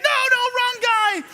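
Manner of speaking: high, loud protest; panicked shout. Retold or imitated. Johnny shouting at the officer that he has the wrong man. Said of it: "Johnny's like".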